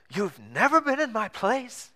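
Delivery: put-on incredulous question in a Greek accent, pitched high; high, drawn-out Greek-accented astonishment. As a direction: imitate a disbelieving Greek theater owner, slow and rising. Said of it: "a little bit of a Greek accent".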